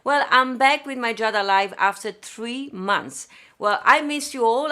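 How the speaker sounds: ordinary delivery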